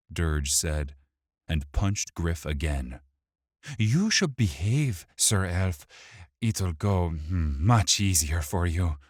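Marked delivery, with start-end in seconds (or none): 3.8-9.0 sneering, mocking villain. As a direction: taunt him with a smirk. Dirge threatening.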